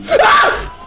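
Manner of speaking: short, loud high shriek; sudden jump-scare yelp; startled scream. Real, not acted. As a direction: scream out in fright. Jump scare in horror game.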